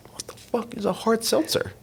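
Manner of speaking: high incredulous mock [name]; imitating [name], pitched high and baffled; [name]'s baffled reply. [name] voiced in retold call.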